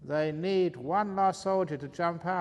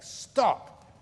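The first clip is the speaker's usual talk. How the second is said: sharp stop; 7 semitones higher, 5 dB louder than usual. Acted. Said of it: "it's really giving me a headache".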